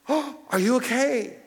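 gasp, then high, drawn-out concern; acted gut-punched concern; shocked compassion. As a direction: gasp sharply and ask, deeply alarmed for a stranger. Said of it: "The third guy saw him and went".

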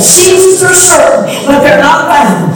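high, loud preaching climax, singing the phrases; full-force preaching. Acted. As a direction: preach it out to the congregation, rising.

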